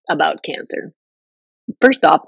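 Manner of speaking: usual speaking voice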